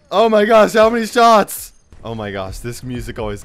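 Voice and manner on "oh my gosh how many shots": loud, higher-pitched exclamation; alarmed shout. Real, not acted. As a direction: shout it in disbelief mid-fight. Startled in zombie fight.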